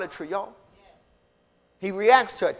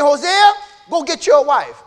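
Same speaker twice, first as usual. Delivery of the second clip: loud, raised preacher's voice as God; God commanding Hosea; 7 semitones higher, 10 dB louder than usual. Acted. God telling Hosea to get a wife, loud command. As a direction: call out the order forcefully.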